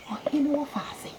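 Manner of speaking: quick breathy whisper. Acted. Whispering slyly to the neighbor, quick whisper. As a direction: drop to a fast secret whisper.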